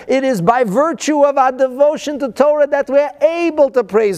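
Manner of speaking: high, heartfelt sermon appeal; preaching. Acted. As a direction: plead warmly from the pulpit. Fervent appeal to congregation.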